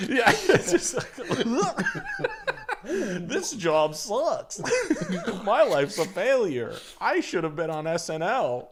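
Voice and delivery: high, slow, laughing through a despairing Goofy; mock despair, cracking up; laughing Goofy lament. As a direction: giggle out a washed-up mascot's misery. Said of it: "Goofy ... blow my head off".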